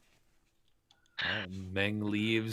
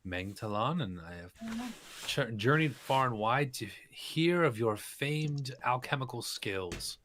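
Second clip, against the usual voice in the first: flowery greeting; 5 semitones higher than usual. Acted. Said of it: "Meng bows and says".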